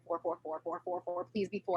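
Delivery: quietly will it to be four; mother praying under her breath, hushed; hushed pleading. Retold or imitated. Silent praying during cervix check.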